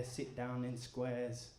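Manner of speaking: usual speaking voice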